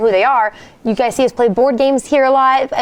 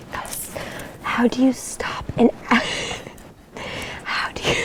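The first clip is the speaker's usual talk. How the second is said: soft giggly whisper; whisper, fighting laughter; 8 dB quieter than usual. Acted. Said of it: "I'm really gonna whisper".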